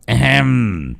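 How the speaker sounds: a deliberate ahem to get attention; throat clearing. Acted. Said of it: "mà tằng hắng". (tằng hắng gây chú ý)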